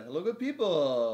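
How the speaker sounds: loud, slow showman hello; booming sign-off greeting. Pretend. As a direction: boom it like a host.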